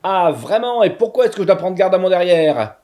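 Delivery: sharp, nasty little voice. Acted. Witch confronting the narrator, sharp and nasty. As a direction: jab it like a spiteful little witch. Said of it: "une petite voix pointue, aigre et méchante".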